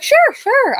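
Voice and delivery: very high, loud, quick assent; eager agreement. Retold or imitated. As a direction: chirp it as the unsuspecting nurse. Unsuspecting nurse agrees to come along.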